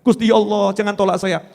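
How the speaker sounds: humble, restrained prayer; begging to God. Acted. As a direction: plead with God at natural pitch. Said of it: "sudahkah kita mengemis seperti itu?".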